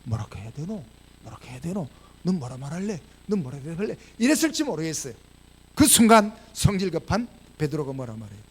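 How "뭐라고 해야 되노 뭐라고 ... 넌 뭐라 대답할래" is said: low, hushed uncertain asking; hesitant undertone. Retold or imitated. Disciples unsure how to answer Jesus, hushed and hesitant. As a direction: confer under your breath. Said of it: "그때 아마 제자들 눈치 봤지 싶어요".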